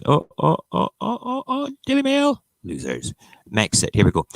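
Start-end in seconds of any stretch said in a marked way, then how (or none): 0.1-2.4 "oh"s climbing to a very high squeak; climbing squeal. Real, not acted. Streamer hunting through the papers, playful rising squeal. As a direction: let the voice climb higher and higher, playfully.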